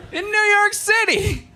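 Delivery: very high, strained outburst; mock outraged cry. Michigan rival meeting a State grad out here, mock outrage.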